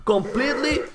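high, loud exasperated ranting; angry rant. Real, not acted. Streamer ranting angrily at a walling opponent. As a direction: rage at the opponent's endless walls. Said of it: "I'm angry, man. I'm angry".

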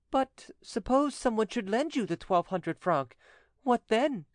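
high-pitched widow, probing gently; hint at an offer in a high woman's voice; coaxing widow. Acted. Widow hints to the notary at a loan, coaxing high voice.

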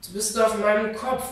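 höher und lauter, überrumpelt; empörter Ausruf. Acted. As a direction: ruft verblüfft auf. Schwester landet auf ihrem Kopf. (Lamina ruft verdutzt der Schwester zu, lauter)

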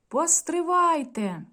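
high, slow call across the distance; a hint of a rider shouting to stop; calling from afar. A narrator's reading. Rider calls out to the executioners to wait, high and drawn out. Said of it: "як один гукає здалеку".

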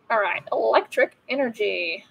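ordinary talk

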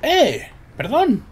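high startled yelp; shocked outburst. Real, not acted. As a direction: caught off guard, loud and high. Car trick goes wrong in game.

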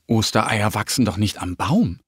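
ordinary delivery, nothing marked

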